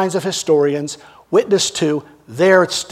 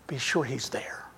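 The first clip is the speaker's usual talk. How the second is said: hushed whisper, like passing on a secret; 5 semitones lower, 14 dB quieter than usual. Retold or imitated. Angel quietly passing word about Peter, whispered. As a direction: drop to a breathy secret whisper.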